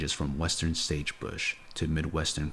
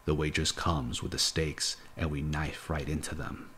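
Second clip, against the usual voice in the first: usual speaking voice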